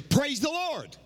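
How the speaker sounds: a hint of a joyful woman's shout of praise; lady calling out. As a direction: call out praise like the bold lady in the pew. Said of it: "she said".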